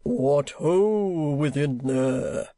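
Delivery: slow, stagey hail sinking at the end; a theatrical deep-voiced call, drawn out. A narrator's reading. Actor hailing his host at the door, mock deep voice. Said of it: "in a deep voice".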